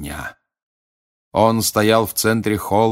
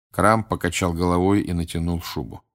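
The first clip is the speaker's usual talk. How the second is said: usual speaking voice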